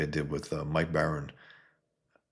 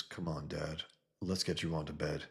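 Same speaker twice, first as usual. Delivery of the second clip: gently coaxing, much softer; 11 dB quieter than usual. Acted. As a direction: lead a tired father to bed gently. Son coaxing drunk father.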